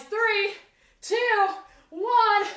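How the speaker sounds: high, loud count down; loud countdown. Real, not acted. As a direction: call the count like a coach. Counting down the final interval.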